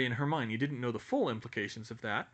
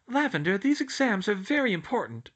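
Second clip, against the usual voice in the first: put-on higher, insistent young girl; earnest schoolgirl voice; 9 semitones higher, 6 dB louder than usual. A narrator's reading. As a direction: press the point, a bit prim.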